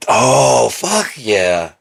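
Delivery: loud, high burst of delight; thrilled, drawn-up exclamation; excited approval. Real, not acted. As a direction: cheer the idea on. Delighted at mini course idea.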